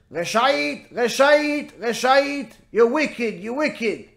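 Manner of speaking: high, repetitive parrot rebuke; scolding parrot. Acted. Parrot denounces the woman on sight, high mock scolding. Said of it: "And the parrot".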